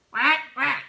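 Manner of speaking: high, loud imitation of a duck quacking; duck quacks. Joke about two little ducks.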